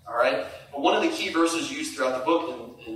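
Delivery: usual speaking voice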